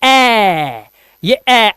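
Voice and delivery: ए drawn out as an open "aee"; split-bamboo AE. Shown as a demonstration. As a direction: open ए wide, like the a in cat. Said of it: "तो ये फटा बांस है".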